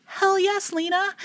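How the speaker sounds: a very high, squealed cheer; the reader cheering on the heroine, a high excited squeal. Real, not acted. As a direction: whoop for her like a fan. Cheering character on while reading.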